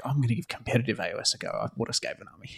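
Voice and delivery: fast, hushed, almost whispered; hushed quote. Retold or imitated. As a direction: rattle it off under your breath. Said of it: "he's like".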